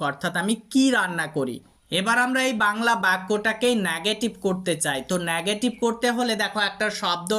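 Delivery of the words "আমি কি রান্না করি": strong emphasis on the word কি; stress on কি. Shown as a demonstration. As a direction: hit কি hard, as in "what". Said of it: "আমরা কি শব্দের উপরে জোর দিচ্ছি".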